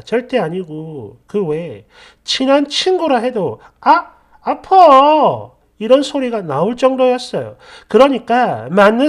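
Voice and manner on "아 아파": loud, high, drawn-out "ow"; acted yelp of pain, stretched out. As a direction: cry out as if smacked hard. Imitates yelp of pain when hit.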